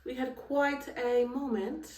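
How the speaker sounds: slow, lightly put-on British accent on quite; trying out a British accent, half joking. To her viewers, a playful stab at a British accent. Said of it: "I just tried to talk in an accent".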